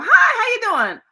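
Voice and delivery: cheerful hello to a grandson on the phone; bright phone greeting. Retold or imitated.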